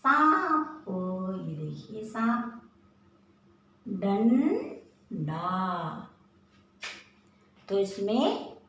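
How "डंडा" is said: डंडा drawn out slowly; teacher modelling डंडा slowly; slow nasal demo. Shown as a demonstration. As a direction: stretch the ं in डंडा. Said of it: "ये अंग अनुस्वार भी है".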